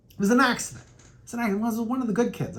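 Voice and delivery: high, protesting excuse; mock pleading. Defending herself to the boss, high mock plea.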